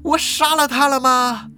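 high, halting, horrified disbelief; stunned and panicky, stretching into a wail. Acted. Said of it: "一股恐慌感打从心里冒了出来啊".

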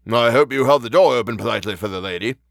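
inspector's mock-polite sarcasm; sarcastic. A narrator's reading. Inspector to the constable after sniffing his breath, sarcastic. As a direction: say it with pointed, sarcastic courtesy. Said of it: "and then sarcastically".

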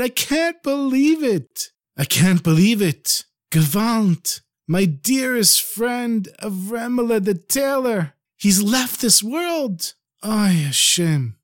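high, slow, drawn-out lament; acted crying. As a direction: grieve aloud as a storyteller's weeping rabbi. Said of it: "crying from the deepest place of his heart".

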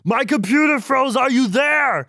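high, strained all caps voice; all caps panic voice. Acted. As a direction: shout every line in all caps. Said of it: "my all caps voice".

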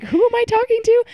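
high-pitched, quick mock disbelief; incredulous. Friend hearing Brandi met a guy, incredulous. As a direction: act baffled by who this is.

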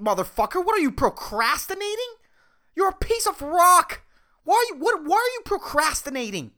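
high, exasperated berating of a lazy rock; mock scolding. Host berating the procrastinating earth, hammed-up exasperation. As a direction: chew out the earth like a slacker.

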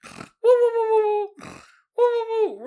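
mock calling hoots. Imitating wife calling sound.

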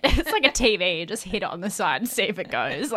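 loud laid-back bloke; she'll be right tradie voice; aussie tradesman. Pretend. Jokey Aussie bloke fix-it advice.

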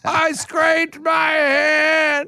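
high, slow, wailing mock sob; a drawn-out, wailing toddler sob; fake crying. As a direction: ham up a toddler's fake tears. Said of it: "kids love to fake cry".

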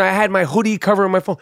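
usual speaking voice

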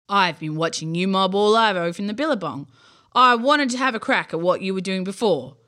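loud, booming creature with Aussie slang; big friendly monster speaking up; deep voice. Acted. Bunyip explains to campers, booming creature voice. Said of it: "it said in a deep voice".

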